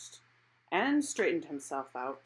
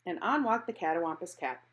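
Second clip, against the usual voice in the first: usual speaking voice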